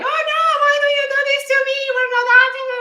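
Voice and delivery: high, whiny voice of a woman who has been objectified; high mock protest; mock offended woman. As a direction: squeal the complaint like an offended lady. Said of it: "they are, like, caring".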